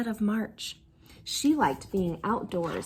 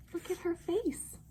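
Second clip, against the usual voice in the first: soft aside; 6 semitones higher, 10 dB quieter than usual. Real, not acted. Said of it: "reader aside to child".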